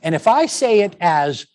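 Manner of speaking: ordinary delivery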